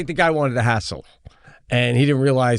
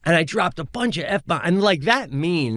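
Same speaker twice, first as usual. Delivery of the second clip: ordinary delivery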